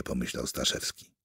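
ordinary delivery

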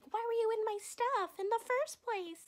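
high-pitched bratty complaint. Retold or imitated. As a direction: protest like an entitled kid.

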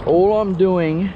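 normal talking voice

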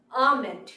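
"almond" as AH-mend; correct pronunciation. Shown as a demonstration. Teacher repeating the correct word, "almond" as AH-mend. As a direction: say "almond" with silent l, falling. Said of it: "the correct one is".

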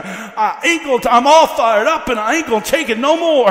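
imitating a wound-up man in preaching cadence; loud, fired-up retold outburst; all wound up. Preacher imitating a man from his father's tent, all wound up. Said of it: "he'd get all wound up".

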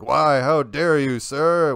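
loud, high theatrical indignation; mock outrage. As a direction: lash out like an affronted duelist, hammed-up. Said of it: "If I lashed out".

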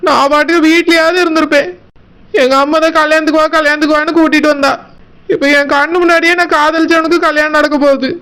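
ordinary talk, nothing marked

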